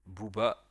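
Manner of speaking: Bouba in two clear syllables, "bou-ba"; model for repeating. Shown as a demonstration. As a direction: say Bouba firmly for a learner to echo. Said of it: "essaye de répéter après moi".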